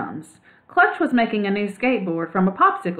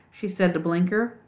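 ordinary delivery

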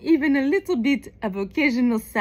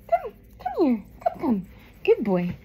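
calling a pet; 4 dB quieter than usual. Real, not acted. Owner calling the dog to come, coaxing pet-talk. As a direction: coax the animal over gently.

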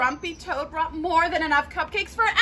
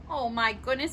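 ordinary delivery

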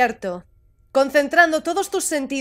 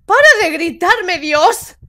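loud, higher-pitched protest; exasperated snapping; 4 semitones higher, 5 dB louder than usual. Acted. Panicking character tells the helper to stop yelling, exasperated. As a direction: snap back in irritation.

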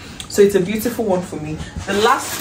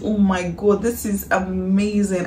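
ordinary talk, nothing marked

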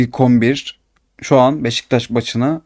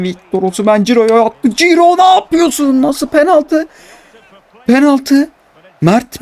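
outraged protest; 8 semitones higher than usual. Real, not acted. Player role-playing, protesting the denied penalty to the referee, outraged yell.

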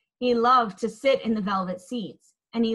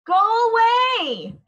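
slow, high and loud; boy shouting at the tornado; shouted; 11 semitones higher, 6 dB louder, slower than usual. A narrator's reading. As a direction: yell it at the storm. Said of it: "Holler shouted at the tornado".

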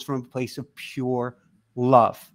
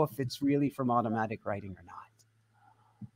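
whispered doubt; 6 semitones lower, 7 dB quieter than usual. Real, not acted.

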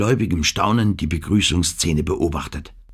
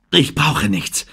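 gasping; 7 semitones higher, slower than usual. A narrator's reading. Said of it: "keuchte Ann".

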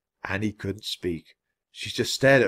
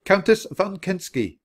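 servant's raised announcing voice; loud announcement; 10 semitones higher, 3 dB louder than usual. A narrator's reading. Servant announces the Countess to the room, loud formal announcement. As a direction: announce the guest formally, high-pitched. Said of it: "spoke in a loud voice".

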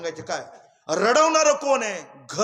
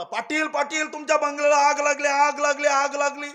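higher, louder, fast panicked calls of आग लागली; alarmed shouting; 6 semitones higher, 4 dB louder than usual. Retold or imitated. Neighbour running to Patil, shouting the alarm. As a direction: yell the alarm across the village. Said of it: "ओरडत आला".

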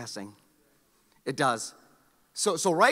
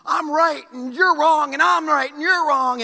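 high, loud, slow insistence; hammed-up "I'm right" chant; mock self-righteous shout; 9 semitones higher, 9 dB louder, slower than usual. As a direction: parody a stubborn arguer, higher and louder. Mocking people who insist they're right.

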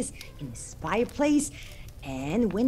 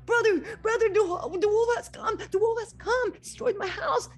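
much higher, fast, frightened little pig voice; panicked piglet; 14 semitones higher, 3 dB louder than usual. Acted. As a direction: squeal the news in a panic.

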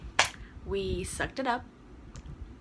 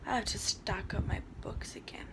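whisper; 4 semitones lower, 6 dB quieter than usual. Real, not acted. Muttering aside while recording.

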